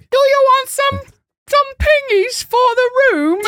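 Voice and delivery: high, loud, drawn-out British accent. Shown as a demonstration. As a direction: squeal it slowly in an over-the-top British voice. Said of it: "read this in a British accent".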